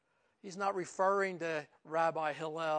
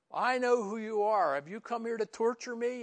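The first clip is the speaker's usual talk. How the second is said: loud, higher demon outcry; demon crying out; 6 semitones higher, 5 dB louder than usual. Retold or imitated. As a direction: shout it like a demon recognizing Jesus. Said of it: "starts crying out".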